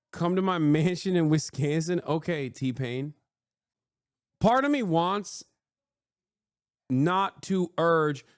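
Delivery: ordinary talk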